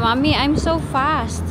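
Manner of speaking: put-on drawn-out, slow childlike quote; slow child quote. Mom slowly imitating her daughter's words. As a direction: stretch it out like the child said it. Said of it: "Sabi niya".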